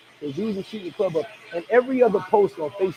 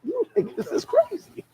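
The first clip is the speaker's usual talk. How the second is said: incredulous; slower than usual. Retold or imitated. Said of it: "I'm like".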